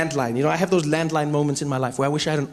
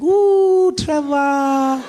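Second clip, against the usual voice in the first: put-on high, slow, delighted old granny; high slow loud granny gushing; grandmother voice; 8 semitones higher, 5 dB louder, much slower than usual. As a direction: stretch it out like a doting gogo. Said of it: "And she's like".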